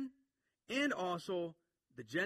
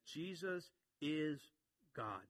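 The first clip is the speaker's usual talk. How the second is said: slow, with a long pause before GOD; emphatic statement; 5 dB quieter, much slower than usual. Retold or imitated. Preacher states John's central point, slow and emphatic. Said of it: "That's his emphasis".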